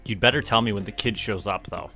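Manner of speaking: ordinary talk, nothing marked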